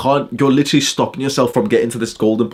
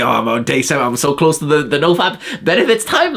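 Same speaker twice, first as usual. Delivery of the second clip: lightly mocking obsessed counter; 3 semitones higher than usual.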